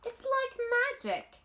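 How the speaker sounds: put-on a young girl marvelling out loud; wonder. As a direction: say it in awe, a little shy. Mary marvels at the garden.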